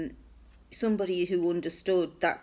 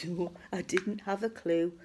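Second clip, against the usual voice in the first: hushed, voiceless reply; whispering; 3 dB quieter than usual. Retold or imitated. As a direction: whisper back conspiratorially. Whispered reply leaving home.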